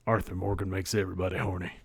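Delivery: put-on southern outlaw voice, quick; southern cowboy voice. Back in the Arthur Morgan voice.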